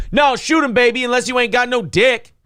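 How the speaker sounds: put-on high, taunting girlfriend voice; hammed-up girlfriend goading her man to shoot; mock girlfriend impression. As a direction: egg him on like a girlfriend daring her man. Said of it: "One of my really good impressions".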